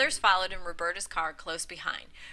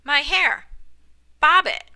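loud, higher clipped command; firm insistence; 7 semitones higher, 9 dB louder than usual. A narrator's reading. Bernice to the stunned barber, loud insistence. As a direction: refuse any argument.

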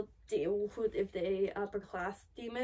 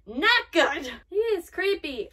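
loud, higher-pitched real dismay; alarmed outburst; 5 semitones higher, 11 dB louder, slower than usual. Real, not acted. Viewer reacting to the creepy demon, alarmed outburst. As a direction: blurt it out loudly, appalled.

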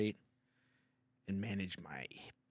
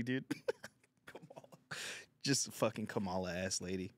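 ordinary talk, nothing marked

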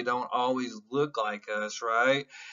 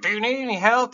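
pushy store clerk; 8 semitones higher, 8 dB louder, faster than usual. Retold or imitated. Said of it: "as soon as I walk into your store".

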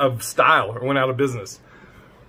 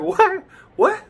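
high, feigned innocent "What? What?"; mock grandma innocence. Reactor mimics grandma dodging the father, high innocent squeak. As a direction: play dumb like grandma, pitched way up. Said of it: "she's like".

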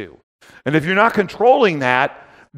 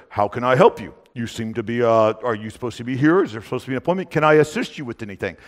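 low, quiet, polite security guard; 6 semitones lower, 5 dB quieter, faster than usual. Retold or imitated. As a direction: imitate a big guard being softly courteous. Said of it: "so he was very polite".

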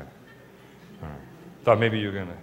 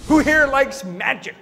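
showman call; 10 semitones higher, 6 dB louder, slower than usual. Acted. Showman calling to the crowd.

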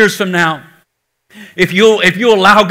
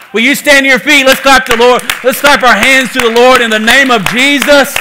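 rally the church to its feet; preacher lifting the room to praise; rousing call; 3 semitones higher than usual. Real, not acted. Rallying the congregation to clap.

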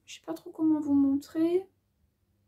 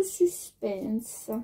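low, drawn-out echo of suspense; mock-ominous suspense; 3 semitones lower than usual. Vlogger building mock tension before measuring, low drawn-out suspense. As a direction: drop it down and stretch it out.

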